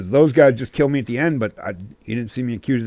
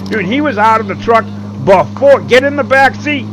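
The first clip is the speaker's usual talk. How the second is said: loud, higher urgent yell; urgent shout; 10 semitones higher, 8 dB louder than usual. Real, not acted. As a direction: shout the order over gunfire. Yelling during firefight.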